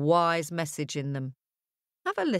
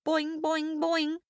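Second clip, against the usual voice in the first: higher bouncy boing sounds; 8 semitones higher, slower than usual. A narrator's reading. Storyteller making bouncy boings, high and springy.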